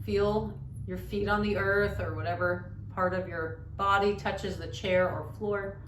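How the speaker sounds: soft-spoken yoga teacher leading a meditation; guided meditation. Shown as a demonstration. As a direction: guide listeners gently into stillness, quietly.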